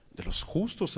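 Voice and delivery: whispered answer. Acted. Preacher answers own question.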